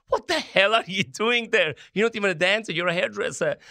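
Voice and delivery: mock his friends calling out in disbelief; teasing friends.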